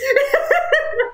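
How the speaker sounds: squeaky burst of laughter; high laugh. Real, not acted. Joking about ghosts.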